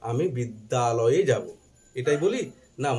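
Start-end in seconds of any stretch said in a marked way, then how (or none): none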